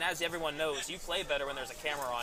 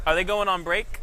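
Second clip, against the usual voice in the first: loud, rising call across the room; 4 semitones higher, 8 dB louder than usual. Real, not acted. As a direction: shout the question to someone away. Calling out to crew off-mic.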